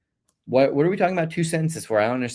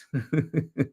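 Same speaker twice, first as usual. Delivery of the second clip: short, quiet chuckle; amused chuckle; 4 dB quieter than usual. Real, not acted. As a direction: chuckle softly at a viewer's hot take. Amused by viewer's basketball take.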